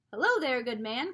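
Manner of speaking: loud call to a stranger down the street. A narrator's reading. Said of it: "called Father".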